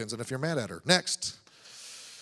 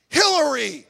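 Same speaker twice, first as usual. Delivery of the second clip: higher, louder mock cuss, laughing; mock angry curse; 7 semitones higher, 8 dB louder than usual. Preacher jokingly swearing with a politician's name, loud mock shout. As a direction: yell the name like a swear word, half laughing.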